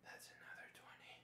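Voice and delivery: soft breathy whisper between sets. Real, not acted. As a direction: keep it hushed, mom is sleeping. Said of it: "I have to whisper".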